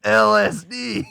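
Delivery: slow, loud, high letters through laughter; laughing mock Jim voice; imitating Jim laughing. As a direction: spell it out while cracking up. Jim punchline repeated.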